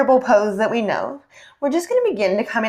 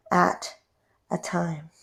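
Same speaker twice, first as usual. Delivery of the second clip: soft slow cue; 9 dB quieter, much slower than usual. Acted.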